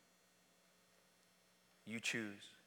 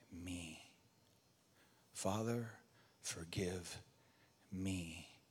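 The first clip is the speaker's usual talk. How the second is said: hushed prayer; 4 semitones lower, 3 dB quieter, slower than usual. Acted. Personal prayer of repentance.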